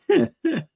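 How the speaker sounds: squeaky high giggle; a last high squeal of laughter. Real, not acted. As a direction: tail end of a laughing fit. Still laughing.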